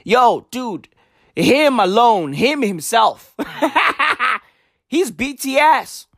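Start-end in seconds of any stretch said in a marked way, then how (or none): 3.4-4.4 short laugh. Real, not acted. Laughs at own joke.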